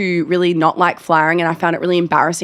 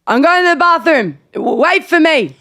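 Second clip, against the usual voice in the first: high-pitched holler to the stage; mock drunken announcement; 8 semitones higher than usual. Drunk Sheldon to the performers mid-show, mock drunken announcement. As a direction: call it out high, as a tipsy heckler.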